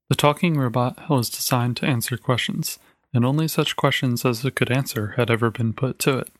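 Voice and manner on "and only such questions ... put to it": ordinary delivery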